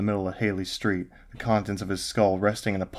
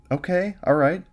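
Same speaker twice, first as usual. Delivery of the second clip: much higher, sighing give-in; sighing girlfriend; 10 semitones higher, 4 dB louder than usual. A narrator's reading. Girlfriend calms him, sighing and high. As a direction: give in with a sigh.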